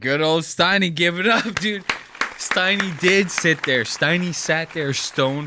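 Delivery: loud mocking jab. Real, not acted. Mocking outburst.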